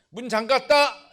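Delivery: loud, slow and drawn out; slow loud call. Shown as a demonstration. Pastor demonstrates calling out the locked door, slow and loud. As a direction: announce it slowly to yourself. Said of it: "이렇게 해야 되는 거야".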